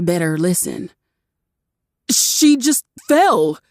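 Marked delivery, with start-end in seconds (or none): 2.1-3.6 higher, slow desperate excuse; husband lamely excusing himself; pleading man. A narrator's reading. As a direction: stretch out a feeble excuse. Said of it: "the man cried".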